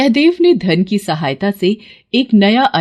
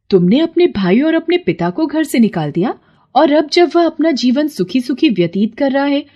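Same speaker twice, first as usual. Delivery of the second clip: goddess rebuking a greedy man; indignant scolding; 3 semitones higher than usual. Acted. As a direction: scold him, pitch leaping up in outrage.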